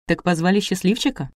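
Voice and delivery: ordinary delivery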